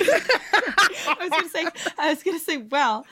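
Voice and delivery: laughing protest. Real, not acted.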